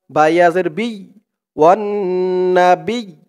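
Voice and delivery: ordinary talk, nothing marked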